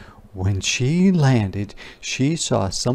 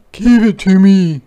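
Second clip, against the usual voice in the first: high, loud demand; demanding boy; 9 semitones higher, 10 dB louder than usual. Acted. Martin loudly demands the camera from Ruthie. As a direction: grab for it with your voice. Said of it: "said Martin".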